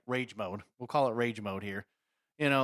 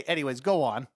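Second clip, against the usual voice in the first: normal talking voice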